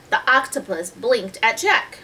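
ordinary delivery

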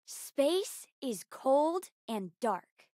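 slow, soft, measured recital; hushed, deliberate poetry reading; poem recital. Acted. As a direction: recite it like a haiku, with long pauses. Reciting own haiku poem.

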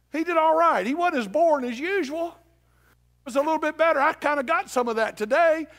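high, loud shouted exhortation; full-force preaching. Real, not acted. Preacher warns congregation against going home unchanged, full-force preaching. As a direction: preach it at the top of your voice.

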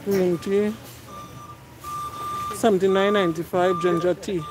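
ordinary talk

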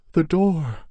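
put-on high, terrified girl's whimper; a frightened girl gasps it out, trembling. A narrator's reading. Girl to her brother after the attack, whimpering in terror. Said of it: "she whimpered".

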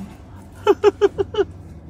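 breathy high laugh; high giggle. Real, not acted. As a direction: giggle lightly. Laughs after outro.

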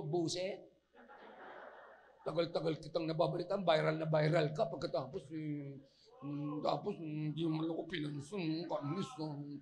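low, soft muttered complaint; mock grumbling. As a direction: grumble under your breath like someone snubbed. Acts out a disgruntled leper muttering.

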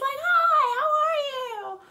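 very high, loud, stretched-out star greeting; hammed-up pop-star squeal, a bit froggy. Fan imitates the star greeting her, froggy high impression. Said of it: "And a bit more [name]-y".

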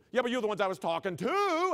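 loud, high mock teacher comeback; argumentative teacher retort. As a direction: argue back at a student, hammed up. Acting out worst teacher arguing back.

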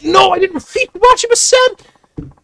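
loud, very high drawn-out yell; slow high shriek of protest; panicked protest. Real, not acted. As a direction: shout a frantic denial, pitch soaring. Horrified protest while streaming.

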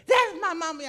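put-on high, loud child's blurt; little girl. Little girl tells the principal who her mom is, high and loud. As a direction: pipe up like a proud little girl.

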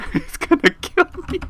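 very high, loud playful squeak of fear; squeaky mock terror. Sure the killer is coming for her, squeaky mock terror. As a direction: squeal it half laughing.

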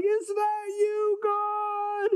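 high, drawn-out wail; frightened calling out. Acted. Elijah calls to God during the earthquake, wailing high. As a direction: call out slowly in a very high voice.

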